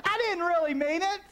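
high whiny "I didn't really mean it"; mock a lame apology in a high whine; whiny mock excuse.

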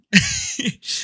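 high giggle; real high laughter. Real, not acted. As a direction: burst into a high-pitched laugh. Breaks into a laugh.

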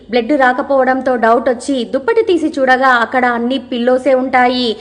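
usual speaking voice

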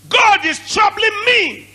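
high, slow shouted preaching; full-force preaching. Acted. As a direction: shout the verse out slowly.

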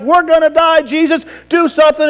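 high, freaked-out plea without a full scream; mock panicked screaming. Terrified sailors begging Jesus to act, mock panic. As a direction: act out a panicking cry for rescue. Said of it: "screaming, panicking, freaking out".

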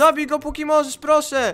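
high, loud begging; urgent plea. Real, not acted. Player begging friend to kill the Wither, urgent shouting. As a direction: plead at full voice, urgently.

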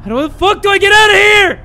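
high, loud frantic cry; real fear yelled at the screen; panicked yell. Real, not acted. Panicked in horror game.

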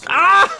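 a very high, loud scream of despair; anguished scream. Real, not acted. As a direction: scream as the play collapses. Play fails, he screams.

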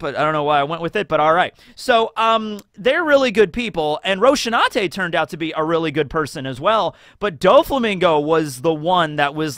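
usual speaking voice

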